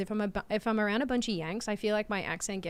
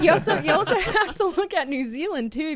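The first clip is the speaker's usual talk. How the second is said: loud, higher, breaking into laughter; laughing through it; 7 semitones higher, 7 dB louder than usual. Real, not acted. Amused at own nonsensical sentence, laughing as they talk. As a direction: laugh while starting the sentence over.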